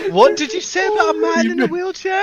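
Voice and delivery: high, loud mock outrage; mock offended. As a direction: act offended, playfully. Mock-offended challenge at the table.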